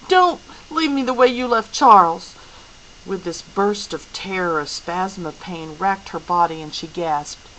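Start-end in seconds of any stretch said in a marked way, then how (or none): 0.1-2.4 high, loud, frightened shout; shouting at father. A narrator's reading. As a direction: throw the plea at him full voice. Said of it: "Meg shouted at her father".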